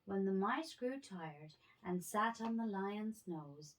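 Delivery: ordinary delivery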